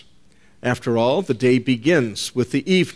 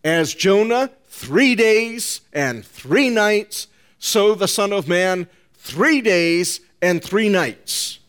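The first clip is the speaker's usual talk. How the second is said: higher and slow, every three stressed; emphatic three; 6 semitones higher, slower than usual. Shown as a demonstration. Preacher driving home the fourfold three to his flock. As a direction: hammer each three in three days and three nights. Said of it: "Four times he stated the word three".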